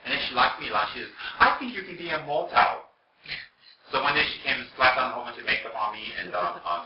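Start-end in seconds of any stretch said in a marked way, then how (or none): 1.4-2.9 put-on high, loud, accented compliment; imitating the lady. Imitating the German lady's compliment.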